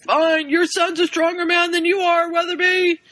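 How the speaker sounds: high, scornful coach voice. Retold or imitated. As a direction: sneer at the father. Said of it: "he's like".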